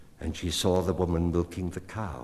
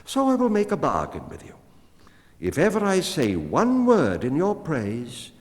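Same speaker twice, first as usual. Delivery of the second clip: high, fast, pleased woman's voice; flattered into a bargain; 10 semitones higher, 6 dB louder than usual. A narrator's reading. Woman flattered by the cat offers a bargain, high and quick.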